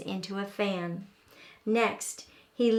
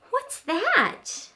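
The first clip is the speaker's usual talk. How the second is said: put-on high, eager child's question; eager little raccoon; 11 semitones higher than usual. A narrator's reading. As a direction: ask eagerly like a small kid.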